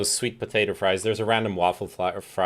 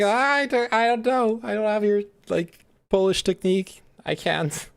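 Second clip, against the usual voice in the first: high, whiny defensive voice; whiny excuse; 12 semitones higher than usual. Retold or imitated. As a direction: whine like a scolded kid. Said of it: "and I'm like".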